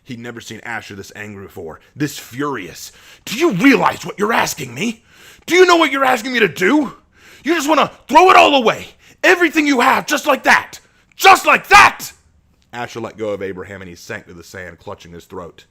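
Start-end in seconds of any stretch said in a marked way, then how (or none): 3.2-10.8 much higher, much louder ranting; furious shouting. Acted. Asher raging at Abraham for wanting to be a vampire. 11.1-12.1 even higher, louder, rising on just; screaming in fury. Acted. Asher screaming at Abraham, fury peaking. Repeated, escalated.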